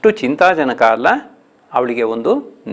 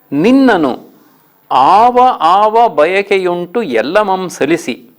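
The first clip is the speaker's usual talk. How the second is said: higher, slow chanted verse; poem recitation; 6 semitones higher, 3 dB louder, slower than usual. Shown as a demonstration. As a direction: lift and draw out the verse. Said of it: "ಹದಿನಾರನೇ ಪದ್ಯ". (ಎತ್ತರದ ಧ್ವನಿಯಲ್ಲಿ ನಿಧಾನ ಪದ್ಯ ವಾಚನ)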